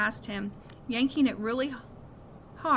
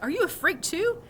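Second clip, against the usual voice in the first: lightly imitating the bully, higher; mock bully; 9 semitones higher, 3 dB louder than usual. Miles mocking the bully's taunt, lightly. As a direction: sneer it back as a joke. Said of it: "He was like".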